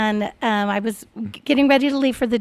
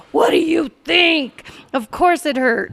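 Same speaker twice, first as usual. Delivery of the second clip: retold angry retort; 5 semitones higher, 3 dB louder than usual. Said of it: "she was mad at her husband".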